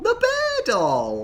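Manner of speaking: very high, slow squeal of delight; excited cry. Real, not acted. Player finding the bear doll, excited squeal. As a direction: draw it out high with glee. Cheering at a game find.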